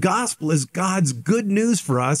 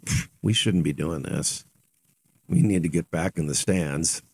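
softer, lower remorseful mutter; sheepish kid; 10 semitones lower, 5 dB quieter than usual. Retold or imitated. Kid on the field realizing he should go back, quiet and sheepish. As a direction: quietly own up to the mistake. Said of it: "some of the kids would have said".

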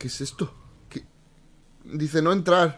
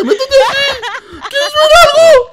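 high sing-song cheerful voice; Noa, all happy; 6 dB louder than usual. Retold or imitated. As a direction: lure them in sweetly. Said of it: "me dice la Noa todo feliz".

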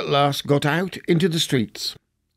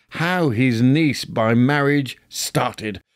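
plain reading voice

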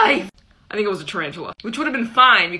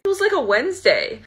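usual speaking voice

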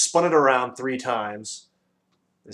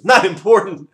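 loud, high outraged roar; 8 semitones higher, 14 dB louder than usual. A narrator's reading. The furious Dodecahedron roars at Milo. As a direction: bellow it in fury. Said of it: "roared the dodecahedron".

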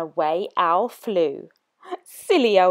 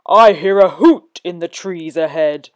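Gruffalo voice; 3 semitones lower, 4 dB louder than usual. Acted. Gruffalo threatens the mouse, low and hungry. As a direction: growl it like a hungry beast.

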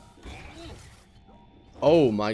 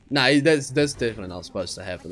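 usual speaking voice

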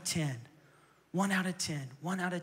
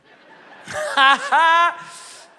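big laugh; 10 semitones higher, 14 dB louder than usual. Real, not acted. Laughs at his own joke.

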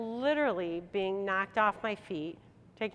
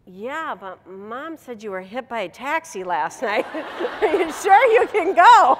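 niece; 4 semitones higher, 12 dB louder, slower than usual. Retold or imitated. Niece's worried question.

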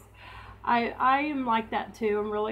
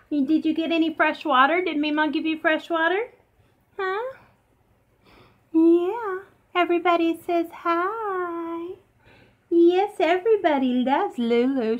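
higher, cooing voice to the dog; pet talk; 6 semitones higher, 4 dB louder than usual. Real, not acted. Owner cooing higher at her dog on a livestream.

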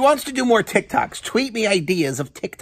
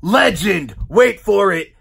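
wrestling promo climax; 3 semitones lower, 6 dB louder than usual. Pretend. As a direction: proclaim the sign-off, stretching the last word.

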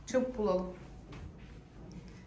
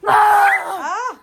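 alarmed cry; 14 dB louder than usual. Pretend. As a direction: scream in fright as toy. Toy cries out.

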